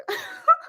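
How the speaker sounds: high, squeaky little laugh; a giddy squeal of a laugh. Real, not acted. Laughs wanting to hug Bible.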